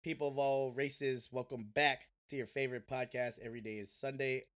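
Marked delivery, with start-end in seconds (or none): 0.0-1.3 grand showman opening; ringmaster. Acted. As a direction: call it out like a circus ringmaster. Podcast intro announcer style.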